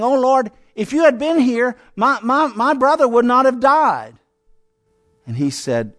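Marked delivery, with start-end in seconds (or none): 0.0-4.2 higher, louder retold lament; preacher crying out Martha's plea; anguished lament. Sister to Jesus about her dead brother, lamenting.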